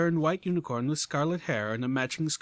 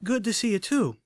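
cheerful; 8 semitones higher, 4 dB louder than usual. Acted. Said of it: "with a bit of cheer".

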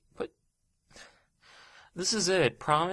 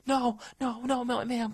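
ordinary delivery

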